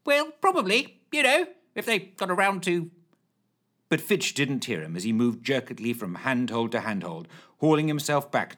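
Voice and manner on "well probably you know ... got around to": high hesitant flustered voice; flustered Lushthorpe. Acted. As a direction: dither high and unsure.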